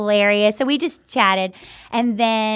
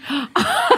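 a sharp high gasp breaking into a laugh. Real, not acted. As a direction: gasp with delight. Excited gasp retelling the moment.

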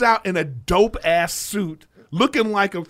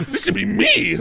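laughing mock voice; 13 semitones higher than usual. Voicing girlfriend's mom.

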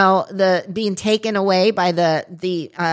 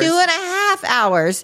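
slow, higher, crying disbelief; mock anguish; 8 semitones higher than usual. Real, not acted. Friend repeating the length in stunned disbelief, slow and whiny. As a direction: wail it in mock despair.

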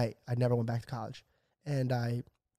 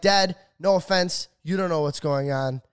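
heated retort to dad; 5 semitones higher, 6 dB louder than usual. Retold or imitated. Acting out argument with dad.

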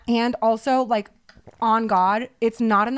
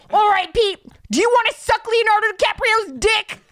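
blunt mom question; 11 semitones higher, 5 dB louder than usual. Retold or imitated. Mom's blunt question in the bit.

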